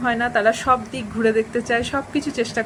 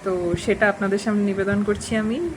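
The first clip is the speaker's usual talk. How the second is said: ordinary talk, nothing marked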